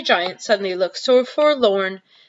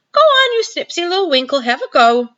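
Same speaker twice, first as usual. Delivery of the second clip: much higher, louder teasing urge; coaxing giant; 9 semitones higher, 5 dB louder than usual. Acted. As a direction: tease her into tasting.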